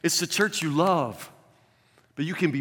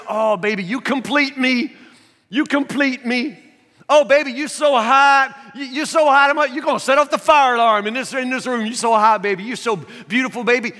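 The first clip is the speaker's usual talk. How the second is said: mock newlywed sweet talk; 9 semitones higher, 10 dB louder than usual.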